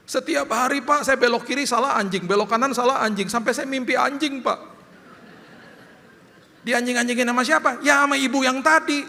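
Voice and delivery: imitating the driver's exasperated complaint; upset driver. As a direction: rant like a fed-up chauffeur. Driver complains about his boss.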